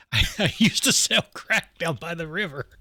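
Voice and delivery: laughing through the line. Real, not acted. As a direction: crack up while trying to stay in character. Speaker breaks up at own joke.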